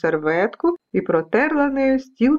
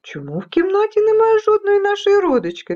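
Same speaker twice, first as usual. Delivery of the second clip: very high, anxious little voice; high-pitched storybook alarm; worried dust specks; 11 semitones higher than usual. Acted. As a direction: fret about the missing relatives. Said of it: "тривожилися вони".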